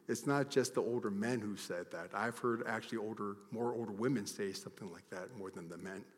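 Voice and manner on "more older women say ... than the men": ordinary talk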